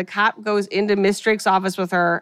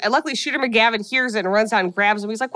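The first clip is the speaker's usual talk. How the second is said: ordinary talk, nothing marked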